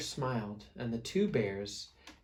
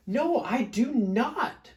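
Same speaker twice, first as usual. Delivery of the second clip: slow, much higher hammed-up growl; mock growl; 10 semitones higher, 5 dB louder, slower than usual. Smelly Bear growls back in the quarrel, hammed-up. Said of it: "growled Big Smelly Bear".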